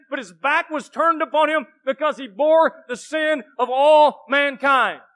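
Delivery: loud, rising sermon, words hammered home; preaching at full force. Acted. Pastor preaching to his church, loud and fervent. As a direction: shout the sacrifice to the congregation.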